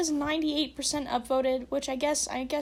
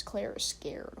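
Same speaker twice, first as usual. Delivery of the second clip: whispered, mock worried; spooky hushed aside; hushed mock concern; 3 semitones lower, 7 dB quieter, slower than usual. Real, not acted. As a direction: whisper it like gossip.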